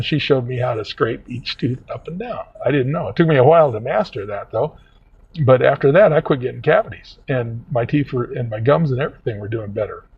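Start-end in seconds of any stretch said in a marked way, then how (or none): none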